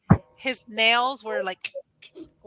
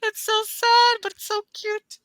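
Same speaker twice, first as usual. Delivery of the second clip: high cooing voice; 11 semitones higher, 6 dB louder than usual. Real, not acted. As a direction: coo it like over a pet. Cooing over old cat.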